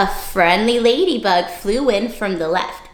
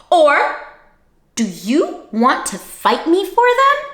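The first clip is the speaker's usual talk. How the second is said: grouchy challenge; 6 semitones higher than usual. Acted. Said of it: "the grouchy ladybug".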